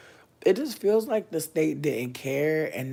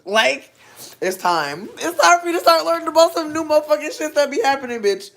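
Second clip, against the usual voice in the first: loud, high-pitched exhortation; emphatic yelling; 14 semitones higher, 13 dB louder than usual. Real, not acted. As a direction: yell it up high, urging them to wise up. Exhorts viewers to learn, yelling.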